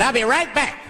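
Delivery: high-pitched put-on voice, rising; driver's offhand excuse. Limousine driver leaving his passenger, high mock-casual promise. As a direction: toss it off high while heading out.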